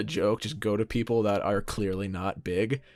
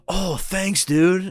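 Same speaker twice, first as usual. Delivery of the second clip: surprised thanks; 9 semitones higher, 6 dB louder, much slower than usual. Retold or imitated. Friend reacting awkwardly, retold.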